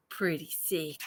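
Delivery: low, quiet growl of approval; low mock cool. Real, not acted. Vlogger admiring her plant, low growl.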